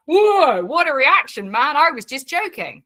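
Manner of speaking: acting baffled at the overreaction; startled exclamation. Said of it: "And I'm like".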